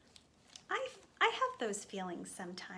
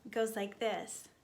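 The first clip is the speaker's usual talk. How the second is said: normal talking voice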